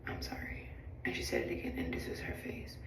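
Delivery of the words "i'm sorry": whispered retold apology; subdued apology. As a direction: imitate a very soft, breath-only sorry. Voices passenger's second apology.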